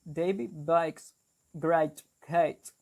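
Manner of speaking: slow Brummie demo, BAKES sounding like 'bikes'; Brummie accent. Shown as a demonstration. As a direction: repeat 'great cakes' the Brummie way, with 'eye' vowels. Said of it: "Brummie accent".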